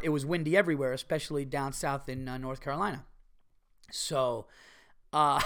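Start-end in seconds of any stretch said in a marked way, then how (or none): none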